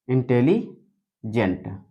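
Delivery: Intelligent slow and soft, long gap before gent; split in two. Shown as a demonstration. Teacher sounding out the word while showing it, slow and split. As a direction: softly say Intelli, pause, then gent in intelligent. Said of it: "gent अलग हो गया".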